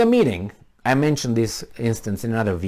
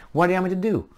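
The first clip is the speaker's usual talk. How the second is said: ordinary talk, nothing marked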